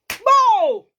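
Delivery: a high, loud burst of pride; loud exclamation. Real, not acted. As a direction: shout it out in triumph. Bragging exclamation.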